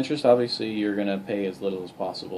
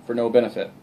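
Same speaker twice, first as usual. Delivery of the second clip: ordinary talk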